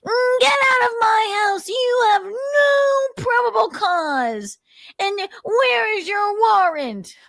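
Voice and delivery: high, slow, whiny indignant shout; exaggerated high slow indignant yelling; indignant mock voice. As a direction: ham up an outraged homeowner. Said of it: "He sounds indignant".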